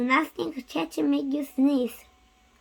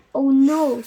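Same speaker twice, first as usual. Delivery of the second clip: alarmed; 6 dB louder than usual. Acted. Gerald realizes pigs cause it.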